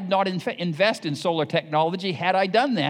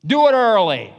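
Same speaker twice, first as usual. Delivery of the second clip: louder, punched command; loud exhortation; 4 semitones higher, 10 dB louder than usual. Real, not acted. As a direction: drive it home to parents. Preacher exhortation, repeated.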